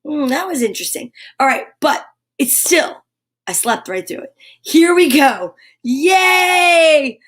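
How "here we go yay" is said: loud, high happy cheer; bright yell of joy to open the show; excited cheer. Real, not acted. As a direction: whoop it up for the crowd. Cheering to start the morning show.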